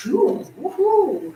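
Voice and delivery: high, slow playful woohoo; hammed-up little whoop; mock cheer. Real, not acted. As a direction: cheer them on in fun. Cheer before doing the form.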